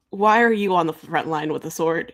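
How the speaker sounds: ordinary delivery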